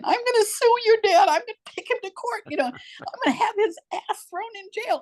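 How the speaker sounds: high-pitched tearful threat; retold crying. Upset mother threatening to sue her children's dad, crying. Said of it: "I'm crying, and I'm saying".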